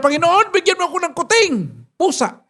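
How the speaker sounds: put-on a kid begging God for a kitten; child's prayer. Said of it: "Nanalangin siya". (nagsusumamong batang nagdarasal, mas mataas ang boses)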